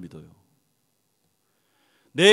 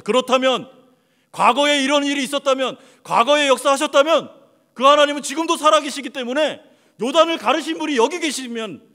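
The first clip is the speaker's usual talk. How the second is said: loud, higher, driving sermon climax; preaching at full force; 10 semitones higher, 8 dB louder than usual. Acted. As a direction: rouse the congregation toward faith.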